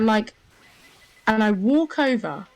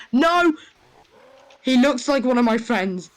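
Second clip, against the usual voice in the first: loud high shout of no; retold panicked yell; 4 semitones higher, 4 dB louder than usual. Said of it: "I've never shouted no in my life so loud before".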